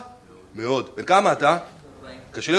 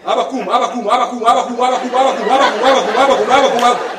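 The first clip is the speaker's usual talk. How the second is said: chanting wake-up call, getting louder; kid nagging; 5 dB louder than usual. Retold or imitated. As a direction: pester dad out of his nap, louder each round. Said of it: "פרץ את הדלת".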